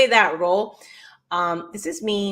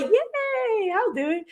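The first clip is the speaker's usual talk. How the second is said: gullible eager self; slower than usual. Retold or imitated. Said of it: "I'm like".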